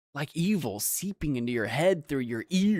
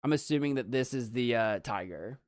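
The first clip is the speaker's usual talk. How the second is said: normal talking voice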